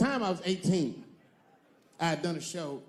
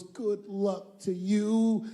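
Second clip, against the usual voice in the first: slow and a little higher; slow, lightly voiced tender woman; 4 semitones higher, much slower than usual. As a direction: draw out the goodbye gently. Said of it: "She goes".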